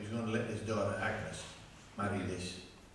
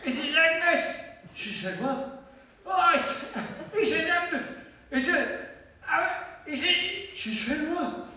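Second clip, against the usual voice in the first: high, loud frantic calls of Agnes; frantic shouting; 13 semitones higher, 4 dB louder than usual. Acted. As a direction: burst in calling her name in a panic. Frantic Agnes/what exchange.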